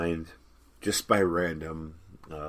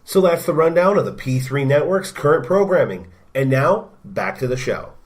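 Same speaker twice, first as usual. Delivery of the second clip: bright promo host voice; promo announcer; 6 semitones higher, 10 dB louder than usual. Acted. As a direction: hype up the network shows. Network promo read.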